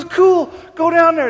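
excited urging. Retold or imitated. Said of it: "He goes".

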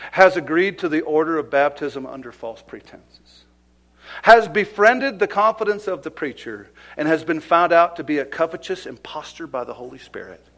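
loud, building denunciation; preaching at full force. Acted. Preacher exposing Simon to the church, loud and building. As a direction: hammer each charge home.

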